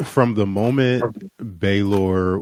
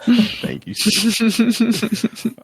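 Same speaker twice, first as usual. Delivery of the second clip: high, quick laughing thanks through giggles; 14 semitones higher than usual. Real, not acted. Host thanking a listener for a funny message, laughing. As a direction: let the giggle lift the whole thanks.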